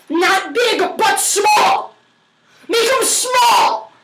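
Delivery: high, slow mock-stern shout; emphatic command. Cook insisting on tiny onion pieces, emphatic command. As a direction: shout the cooking order, drawn out.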